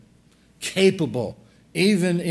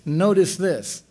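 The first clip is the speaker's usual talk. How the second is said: ordinary delivery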